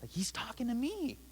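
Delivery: high, breathless whispered aside; a starstruck kid whispering that the big guy noticed him; excited whisper. Retold or imitated. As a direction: whisper it to yourself in awe. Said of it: "And I'm like".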